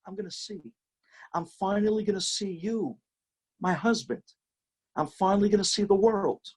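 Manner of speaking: overjoyed, almost in disbelief; wife's joyful voice. Acted. Blind wife's joyful prayer, quoted.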